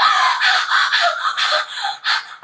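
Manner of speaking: high, repeated mock gasps; happy gasping. Lost girl hearing her dad, imitated joyful gasping. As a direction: suck in breath after breath, overjoyed. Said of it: "so happy".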